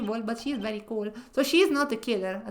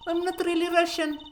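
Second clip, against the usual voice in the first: higher, loud Russian-accented reveal; 5 semitones higher, 4 dB louder than usual. Shown as a demonstration. Witness confessing to the court in a Russian accent. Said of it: "So she doesn't have a Russian accent".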